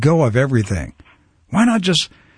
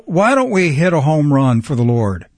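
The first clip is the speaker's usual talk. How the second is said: ordinary talk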